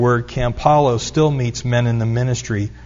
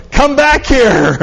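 much higher, louder, slow call; 11 semitones higher, 6 dB louder, slower than usual. Retold or imitated. Mother calling the boys back from the edge, loud. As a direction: summon them back from across the rim.

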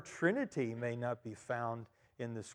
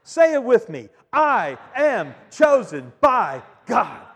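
slow, loud, high proclamation; rousing call-and-response; 8 semitones higher, 12 dB louder, slower than usual. Shown as a demonstration. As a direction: drive the congregation to shout it back. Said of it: "Do you want to try it?".

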